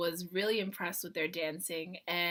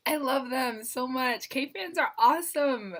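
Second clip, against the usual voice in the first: normal talking voice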